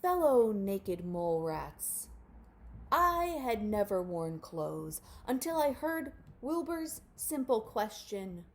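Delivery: loud, grand proclamation; proclaiming elder. A narrator's reading. Grandpa addresses the colony, loud grand speech. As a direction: address the crowd like a king. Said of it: "and proclaimed".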